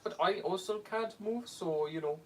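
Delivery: high character voice, easing down; high-pitched toy character. Acted.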